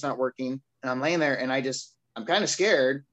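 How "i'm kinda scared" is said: normal talking voice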